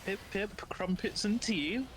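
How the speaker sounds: cartoon British chirp; mock British. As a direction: play up the Britishness in a high, jolly caricature. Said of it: "Yes, Impu is British".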